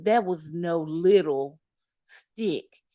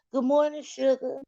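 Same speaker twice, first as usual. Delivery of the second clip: sweet greeting; 7 semitones higher than usual. Real, not acted. Greeting someone arriving.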